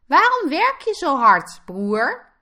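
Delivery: high, loud little piglet voice; questioning piglet. A narrator's reading. Piglet asks his hard-working brother, high-voiced questioning. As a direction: pipe up like a puzzled little pig.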